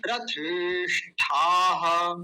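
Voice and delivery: slow, drawn-out रथेष्ठाः with each syllable clear. Shown as a demonstration. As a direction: stretch रथेष्ठाः for learners to follow. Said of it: "मैं बहुत धीरे धीरे बोल रहा हूँ". (रथेष्ठाः बहुत धीरे-धीरे बोलकर)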